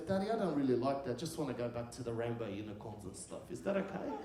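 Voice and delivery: soft, small voice ending on a questioning lift; gently voiced child, quietly asking permission; timid little daughter. Daughter turns down the Batman car, softly and timidly.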